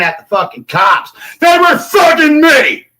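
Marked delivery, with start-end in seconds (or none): 1.4-2.9 high, loud, slow yell; slow furious shout. Real, not acted. Accusing the cops, slow high furious yell. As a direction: drag out each word in fury. Recounting police confrontation.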